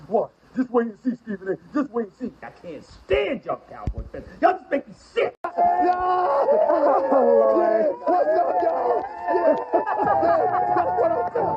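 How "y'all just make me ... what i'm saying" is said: excited shouting. Real, not acted. Shouting in celebration.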